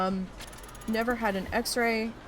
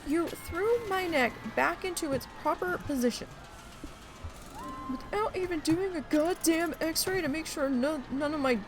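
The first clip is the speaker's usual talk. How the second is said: high-pitched indignant tirade; mad; 7 semitones higher, 3 dB quieter than usual. Retold or imitated. Retelling telling off the doctor, indignant and higher. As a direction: scold him in retold disbelief. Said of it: "oh, I was mad".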